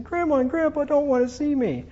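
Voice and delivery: high-pitched retold childhood wail; plaintive high kid voice, hammed up; mock tearful child. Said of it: "I come home crying".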